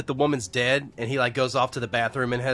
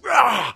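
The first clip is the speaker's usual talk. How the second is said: short, higher-pitched "rah" roar; mock rage growl; 11 semitones higher, 4 dB louder than usual. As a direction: imitate an angry outburst with a wordless roar. Imitating his tantrum outburst.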